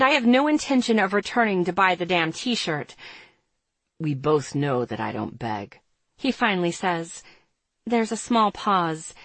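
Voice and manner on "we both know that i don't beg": lower, smooth male voice; Andre's voice. A narrator's reading. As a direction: read him low and cool.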